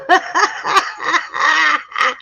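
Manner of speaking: high burst of laughter. Real, not acted. Reading the raccoon story, breaking into a high laugh.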